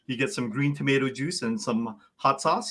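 ordinary talk

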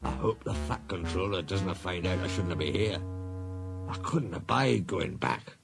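subdued Scottish worry; anxious Scots engine, slowing at the end; worried. A narrator's reading. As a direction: fret quietly to yourself. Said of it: "an awful thought struck him".

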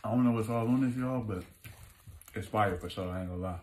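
chewing while talking; talking between bites; mouth full. Real, not acted. Seen 1: Bit burger at -9; mouth still working on food throughout.

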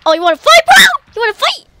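taunting, rising question that leaps high; worked-up squeaky challenge; loud challenge. Real, not acted. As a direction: square up to an opponent. Taunting an enemy in game.

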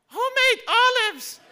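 put-on loud, slow, squeaky child's voice; high-pitched little boy. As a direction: turn the lesson back on dad. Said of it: "his voice was really, like, high-pitched".

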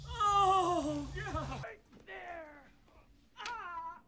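excited. Real, not acted. Cheering a disc near basket.

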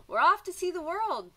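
high, loud, eager call; eager rooster. Acted. Rooster answering the fish, loud and eager. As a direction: answer like a rooster ready for adventure.

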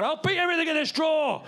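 high-pitched mock shout; hammed-up, high hostage-taker yell; hostage-taker threat. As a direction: yell a wild threat like a cornered kidnapper. Whisk holding the drawer hostage.

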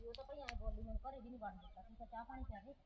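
aunt complaining quietly to herself after the snub; muttering aside. Acted. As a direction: mutter it under your breath, sulking. Aunt grumbles to herself alone.

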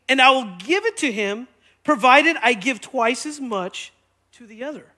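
deliberate, measured read-aloud; slow reading. Real, not acted. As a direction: read it slowly so it can be followed. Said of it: "I'm gonna read it slow".

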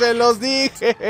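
high, slowed laugh through the words; laughing told-you-so. Real, not acted. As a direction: crack up while gloating. Laughing at the carnage.

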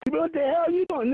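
high mock challenge; imitated partner catching him. Partner catches him jumping on the bed, mock challenge. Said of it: "every time she leave the room".

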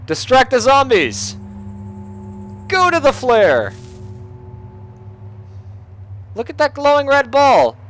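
high, loud shouts to lure a crowd; urgent high-pitched yelling; calling out. Pretend. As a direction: holler to draw their attention. Calling zombies toward the flare.

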